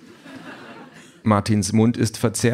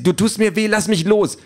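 very high, distressed cry; screaming in pain; 13 semitones higher, 4 dB louder than usual. A narrator's reading. Wife struggling in her husband's grip, screaming. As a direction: cry out to be released, pitch soaring. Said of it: "schreit sie".

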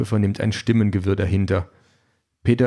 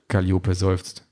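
plain reading voice

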